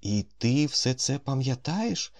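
a hint of a shaken voice; slightly changed voice. A narrator's reading. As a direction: ask it slowly, voice not quite steady. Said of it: "трохи зміненим голосом промовив".

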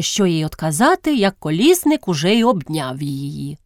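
plain reading voice

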